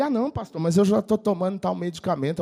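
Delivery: barely audible croak; hoarse voice. Retold or imitated. Pastor voicing the hoarse minister in the car, croaky and soft. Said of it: "Falava bem fanho e quase não dava pra ouvir".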